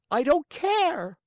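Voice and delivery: high, loud, slow defiant whine; stubborn child. Acted. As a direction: drag out the defiance, shouting. Tantrum continues.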